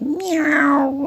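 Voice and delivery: cat meow. Acted. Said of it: "Simpkin said".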